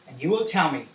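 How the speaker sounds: usual speaking voice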